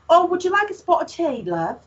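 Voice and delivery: high, mock posh English accent. Southern woman mimicking an English accent to friends, high and lightly hammed. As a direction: put on a high British "spot of tea" voice. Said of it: "what did I learn how to say".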